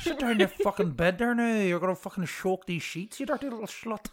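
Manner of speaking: lightly voiced Northern Irish accent, mock seductive; Jamie Dornan accent. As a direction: play Jamie Dornan in his accent, bossy and crude. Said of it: "In that accent?".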